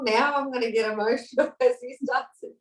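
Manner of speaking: giggling as the feeling rises; laughing. Real, not acted. Moved by the session, speaking to the group and laughing.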